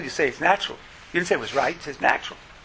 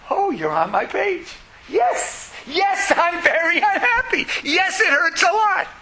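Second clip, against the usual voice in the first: put-on high, slow little child's voice, finally understood; much higher child voice, slow and grateful; relieved child; 11 semitones higher, slower than usual. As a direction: sob out the yes like a comforted child. Child answers the comforting parent.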